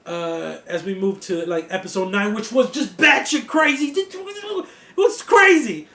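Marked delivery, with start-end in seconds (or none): 3.0-5.9 drawn out, much higher and louder; slow, high marvelling emphasis; excited emphasis. Real, not acted. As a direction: stretch the words with amazement. Stressing how wild episode was.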